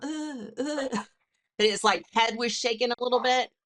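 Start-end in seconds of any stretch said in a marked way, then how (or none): 0.0-1.0 high, soft stuttering grunts; faint high-pitched "uh, uh" sputters; dying squirrel gasps. Retold or imitated. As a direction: mimic a twitching, dazed squirrel. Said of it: "you can't see me going".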